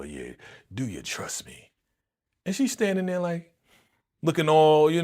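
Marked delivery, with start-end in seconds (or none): 0.0-1.7 low, soft crooning come-on; smooth seducer with vibrato; vibrato for the ladies. Retold or imitated. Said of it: "he talking with the whole, you know, vibrato".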